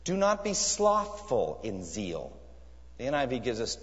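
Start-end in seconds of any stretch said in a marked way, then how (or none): 0.0-2.3 slow literal reading. Shown as a demonstration. Preacher slowly reading the literal translation to the church. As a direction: read the verse slowly, measured. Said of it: "A literalistic translation here is".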